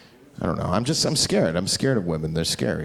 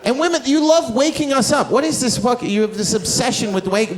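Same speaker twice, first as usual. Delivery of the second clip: normal talking voice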